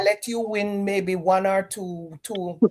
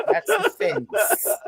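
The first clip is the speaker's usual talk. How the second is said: much higher, slow, laughing through it; amused laughing tag line; 13 semitones higher, much slower than usual. Real, not acted. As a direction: giggle the words out. Laughs at own joke.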